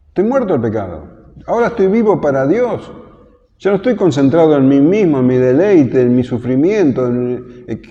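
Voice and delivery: high, loud fervent declaration; fervent preaching. Acted. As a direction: proclaim it with rising conviction. Preacher voices believer's declaration.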